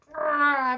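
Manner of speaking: loud, high mock "Rah" growl; the commander storms at the prophet's message; angry growl. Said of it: "He's like".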